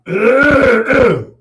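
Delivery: long, loud, high throat clear. Real, not acted. Sick host excuses himself to clear his throat, loud throat clearing. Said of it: "excuse me".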